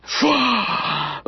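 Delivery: big audible sigh out; long exhale. Shown as a demonstration. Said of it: "and exhale the energy".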